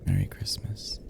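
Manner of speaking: tender whispered wish. Acted. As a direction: whisper it close. Wishing sleepy listener.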